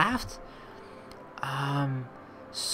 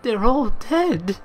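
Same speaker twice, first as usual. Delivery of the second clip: put-on sad boy; 6 semitones lower, much slower than usual. Orphan boy tells travelers family died.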